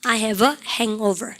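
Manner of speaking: slow, clear model sentence; teacher drilling slowly; slow model phrase. Shown as a demonstration. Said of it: "시작".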